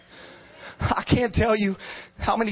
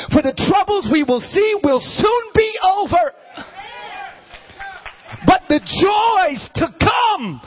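full-force preaching; 8 semitones higher than usual. Real, not acted. Preacher proclaiming that troubles will soon be over, full-force preaching. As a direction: preach it up to a ringing climax.

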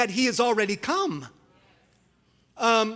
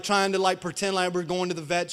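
usual speaking voice

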